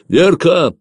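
high, loud name call; shouted call. A narrator's reading. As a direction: yell for someone urgently. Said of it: "крикнул он".

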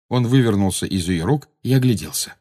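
plain reading voice

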